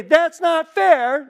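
put-on high, slow, drawn-out toddler whine; whiny baby. Crying baby protesting to a parent, whining. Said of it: "before they can even say".

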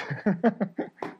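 helpless, rueful chuckle; real laughter. Real, not acted. Preacher laughing because the alternative is crying, real rueful laugh. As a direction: laugh at how horrible it sounds. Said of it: "I'm laughing because".

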